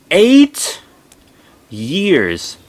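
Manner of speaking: Eight shot up high and loud, years falls back; incredulous echo of his words; stunned disbelief. Real, not acted. She repeats her dad's claim back in stunned disbelief.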